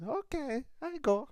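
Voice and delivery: a timid little rabbit squeaking yes and fleeing; scared rabbit. Acted. Preacher's rabbit squeaks in fear at the rat.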